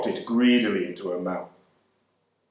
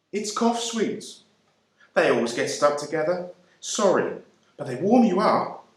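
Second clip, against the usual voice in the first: higher, apologetic voice of a kindly Englishwoman; kind English lady; 6 semitones higher than usual. A narrator's reading. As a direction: fuss gently over the stuck sweets. English woman apologising over sweets.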